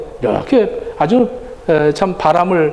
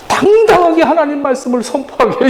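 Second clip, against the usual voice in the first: higher-pitched urging, first word punched; retold rousing encouragement; 6 semitones higher than usual. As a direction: imitate a friend cheering on a preacher.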